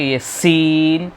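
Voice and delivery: شین stretched very slowly; drawn out. Shown as a demonstration. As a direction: drag out شین. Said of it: "تھوڑا کھینچ کے".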